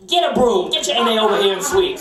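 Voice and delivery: imitating bossy boss. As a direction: bark it in her voice. Boss ordering, voiced.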